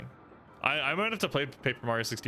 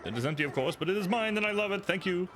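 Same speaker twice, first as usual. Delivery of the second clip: high, gushing grateful voice; overjoyed chef; 9 semitones higher than usual. Acted. Chef thanking the hero for the pot, high gushing. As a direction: beam with gratitude over the pot.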